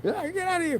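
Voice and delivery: high, laughing panic while fleeing; mock panicked screams. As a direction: shriek it out, half laughing. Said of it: "We're like".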